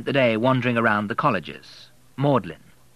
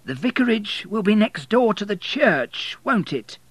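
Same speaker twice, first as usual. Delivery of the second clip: much higher, smug warden voice; smug old man stating the obvious; church warden; 12 semitones higher, 3 dB louder than usual. A narrator's reading. Church warden teasing impatient William, smug and high.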